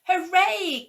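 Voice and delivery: high, loud happy cheer. A narrator's reading. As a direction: shout hooray with joy. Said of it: "cried the scarecrows".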